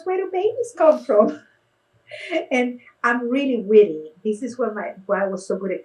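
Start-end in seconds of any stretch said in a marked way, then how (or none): 0.0-1.3 put-on high, loud repeated kid question; high child voice. Five-year-old repeating the question, high child voice. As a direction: ask again like an eager little kid.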